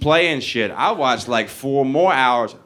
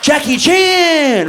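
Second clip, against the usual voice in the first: much higher, louder, slow proclamation; triumphant shout; 6 dB louder, much slower than usual. Acted. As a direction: announce it like a victory cry. Punchline proclaiming his kung fu.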